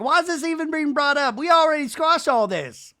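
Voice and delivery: high, loud exasperated imitation; mock exasperated Brittany. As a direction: pitch way up like she's fed up. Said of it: "And Brittany's like".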